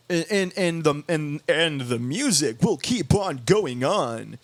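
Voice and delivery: slow, stretched DJ patter with swooping tune; happy guy DJ. Retold or imitated. DJ promising the music will go on, happy guy DJ. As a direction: draw it out like an upbeat club announcer. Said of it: "happy guy D J".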